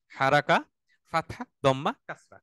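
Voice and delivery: ordinary talk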